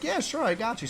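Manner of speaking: mock eager yes. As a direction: toss it off like an easygoing coworker. Coworker agreeing, voiced higher.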